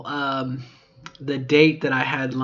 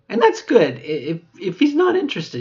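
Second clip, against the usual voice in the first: high, louder put-on cheer; a bright, brave front, pitched up and louder; forced brightness; 7 semitones higher, 7 dB louder than usual. Real, not acted. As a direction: brush it off brightly. Forced cheer after rejection.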